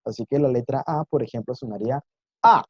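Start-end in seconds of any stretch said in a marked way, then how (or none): 2.4-2.6 "A" falling quickly from high; short, abrupt falling "A"; fourth tone. Shown as a demonstration. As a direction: drop "A" sharply and keep it short. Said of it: "una bajada rápida del sonido de la voz".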